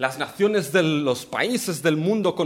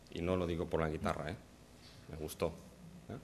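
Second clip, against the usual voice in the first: quiet aside; 13 semitones lower, 16 dB quieter, faster than usual. Real, not acted. Quiet aside about the guitar.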